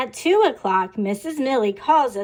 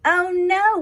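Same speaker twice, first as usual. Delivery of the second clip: high, louder playful cry; mock shout; 8 semitones higher, 5 dB louder than usual. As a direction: call out like a class of kids. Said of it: "we shout".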